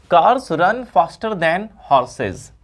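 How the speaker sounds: very slow, each word stretched out; slow dictation. Shown as a demonstration. Teacher dictating the next example to students. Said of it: "देख लेते हैं अगला example".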